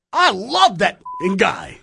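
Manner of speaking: loud, high-pitched showman's gush; mock wound-up exuberance; Regis impression. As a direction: belt it out all wound up, slowly stretched. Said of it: "She said he sounded like Regis".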